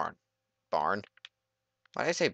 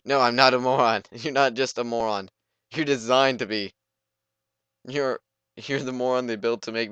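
loud, higher, offended protest; no I'm not a moron, hammed up; mock indignant yelling; 4 semitones higher, 8 dB louder than usual. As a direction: argue back like an insulted kid. Arguing back with game villain.

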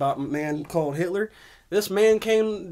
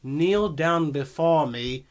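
normal talking voice

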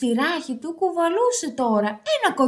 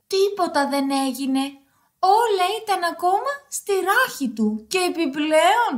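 usual speaking voice

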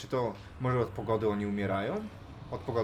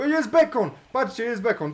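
high, loud cry of delight; excited discovery shout; 11 semitones higher, 9 dB louder than usual. Real, not acted. Gamer spotting the lost pig, excited discovery shout. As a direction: shout that you have found it.

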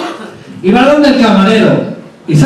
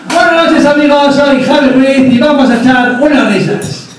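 show presenter; 7 semitones higher than usual. Pretend. Comedian opening his show to the audience, high announcer greeting. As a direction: announce yourself high to the crowd.

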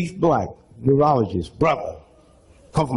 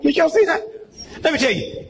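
laughing; 8 semitones higher than usual. Real, not acted. Laughing aside.

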